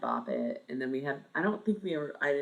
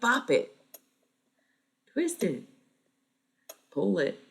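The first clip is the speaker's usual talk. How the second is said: Bop It toy voice; 6 semitones higher, 7 dB louder than usual. Retold or imitated. Imitating the Bop It toy commands.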